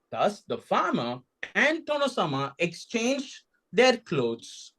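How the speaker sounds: ordinary delivery, nothing marked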